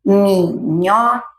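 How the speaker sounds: "Minhoca" in three separate beats, rising at the end; separado em sílabas. Shown as a demonstration. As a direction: count out mi, nho, ca in "Minhoca". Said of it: "vai formando várias palavras". ("Minhoca" pausado em três sílabas)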